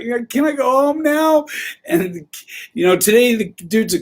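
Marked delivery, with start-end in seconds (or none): 0.0-1.5 put-on a higher, pleading kid's voice; whiny kid. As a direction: ask to go home like a tired kid. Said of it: "And he's like".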